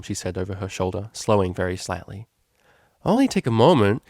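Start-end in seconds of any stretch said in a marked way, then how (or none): 3.0-4.0 insistent Lyra. A narrator's reading. Lyra pressing Octavia to stop.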